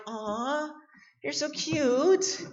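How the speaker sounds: put-on cooing older lady. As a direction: coo like a doting aunt. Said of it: "the ladies ... they're like".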